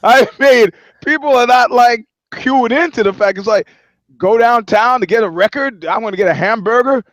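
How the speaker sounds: loud, high-pitched disbelief; incredulous rant. Real, not acted. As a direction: hammer the absurdity home at high volume. Incredulous rant about labor camp.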